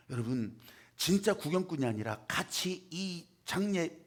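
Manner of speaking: ordinary talk, nothing marked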